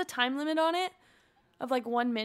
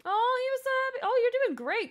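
cooing praise; 10 semitones higher, 6 dB louder, faster than usual. Acted. Praising her sim.

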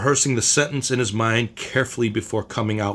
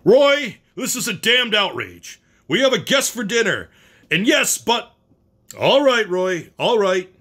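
raised angry voice; 9 semitones higher than usual. Acted. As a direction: blast it into the dead phone. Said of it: "raised his voice at the dead phone".

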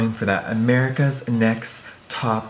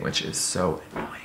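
whispered aside; 5 semitones lower, 9 dB quieter than usual. Real, not acted. Aside about under-eye creasing.

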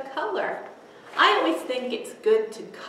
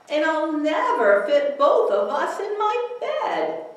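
higher voice through a laugh; laughing child; 10 semitones higher, 6 dB louder than usual. Acted. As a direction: giggle while protesting. Eddie talks to giant bear.

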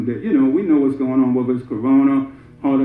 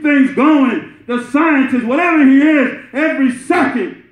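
full-force preaching; 11 semitones higher than usual. Acted. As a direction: preach it out to the hall, building fervour.

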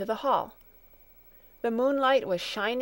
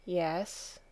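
soft, reluctant yes; low answer; 3 semitones lower, 6 dB quieter than usual. A narrator's reading. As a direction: say it softly, as if meaning no. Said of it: "was the low answer".

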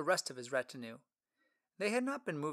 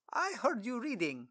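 Philip voice; 8 semitones higher than usual. Acted. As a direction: introduce yourself warmly.

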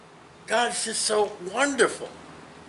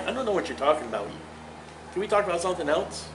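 God playing dumb; 8 dB quieter, faster than usual. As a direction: rush it like God changing the subject. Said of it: "He's like".

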